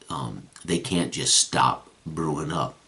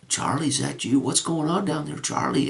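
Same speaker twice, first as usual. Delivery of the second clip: put-on much higher young lad calling down from afar; high young voice yelling from up the hill; 13 semitones higher than usual. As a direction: call down the hill like a teenage boy. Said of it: "some young voice yell down".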